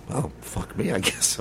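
soft, deflated, resigned under the breath; muttered resignation. Retold or imitated. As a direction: give up quietly, as if to yourself. Resigned reply to doctor.

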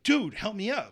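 the mentor calls to the apprentice as the client faints, urgent and loud; urgent call for help. Retold or imitated.

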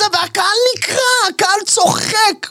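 high, slow storyteller voice, marveling at the crowd; mock announcing. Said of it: "ואני אומר".

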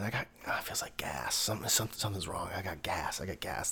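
soft, unvoiced whispers of discomfort; hushed whispering wife. Wife whispering to him that something feels wrong. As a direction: breathe it out like a worried wife.

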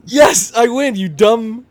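loud high gloating. Real, not acted. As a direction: rub the win in. Gloating at losing friends.

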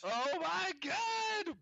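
high, drawn-out fan shriek; screaming fan. Acted. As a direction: squeal like a thrilled fan.

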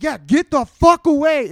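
high, slow, loud retort; full-volume yell. Retold or imitated. Storyteller answering the man by his car, angry reenacted shout. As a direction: shout the confirmation right at him. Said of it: "full fucking loud as I could get".

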